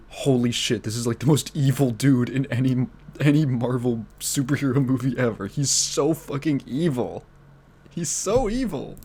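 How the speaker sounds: loud, awed mock outburst, climbing at the end; worked-up disbelief. Fan acting out shock at the villain, worked-up disbelief.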